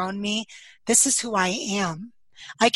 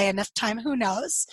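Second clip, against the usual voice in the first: usual speaking voice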